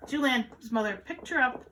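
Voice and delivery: plain reading voice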